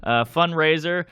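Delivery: hammed-up host at the podium mic; mock emcee intro. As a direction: introduce the comic to a bored room, pompous.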